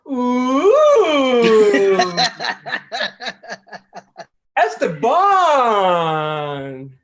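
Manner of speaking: loud, high, drawn-out Esteban; flirty gush. Retold or imitated. Cashier gushes at Esteban's name, high slow swoon. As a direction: swoon over the Latin name.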